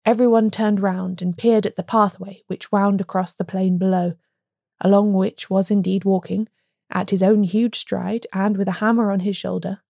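plain reading voice

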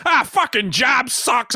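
high, slow mock shout of a tired dad; grumpy forties dad. Mock dad griping home from work. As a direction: bark it like a fed-up old-fashioned dad. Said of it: "the classic nineteen forties dad who comes home and goes".

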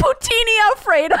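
giggly mock dish name; laughing. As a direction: announce the dish while cracking up.

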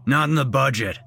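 matter-of-fact. Acted. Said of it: "he stated, very matter-of-fact".

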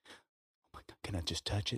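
barely voiced, reverent whisper rising softly; hushed awe. Musician asking producer to touch a famous keyboard, hushed awe. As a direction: ask it like a starstruck fan, very softly.

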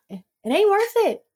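high, playful put-on voice; mock sassy. Mock verdict on lash lift.

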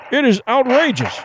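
flustered consul crying out in outrage; indignant cry. A narrator's reading. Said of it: "Consul Lingford cried".

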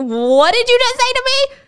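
Sarah repeats it, hurt and indignant; outraged disbelief. Retold or imitated. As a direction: push the outrage into a near-shriek. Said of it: "Sarah is taking this in like".

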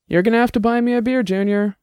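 a quick, pleased tease. A narrator's reading. An old man teasing a young detective with a smug grin. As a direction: let a grin carry the words, fast. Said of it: "grinning now".

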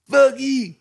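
high, loud mock yell at the booth; heckler yell. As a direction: hurl it up like a drunk heckler. Heckler yells after being stared down.

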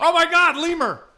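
high, loud excited yell; excited shout. Acted.

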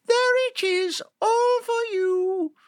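high, slow little lamb; a sweet small lamb showing the way. Acted. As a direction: point it out gently in a tiny high voice. Lamb offers moon to wolf.

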